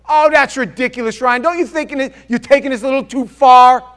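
high, very loud protest; acted scoffing outburst at high pitch; mock indignant objection. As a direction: play an exasperated objector, shouting. Acts out a listener's objection.

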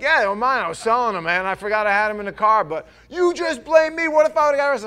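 loud, high, defensive outburst; acted heated quarrel. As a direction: start loud and build to a high yell.

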